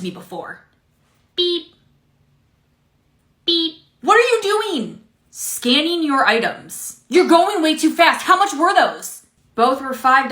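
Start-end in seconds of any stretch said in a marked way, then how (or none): 1.4-3.7 high mock scanner beep. Cashier scanning coupon items. 4.0-9.3 annoyed customer. Acted. Customer annoyed at fast scanning.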